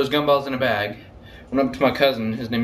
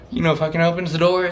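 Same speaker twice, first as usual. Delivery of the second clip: ordinary delivery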